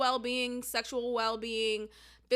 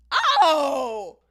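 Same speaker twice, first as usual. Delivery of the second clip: a loud shocked gasp of Oh; startled loud Oh; 10 dB louder than usual. Real, not acted. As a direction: burst out in real alarm. Reacting to shocking reply.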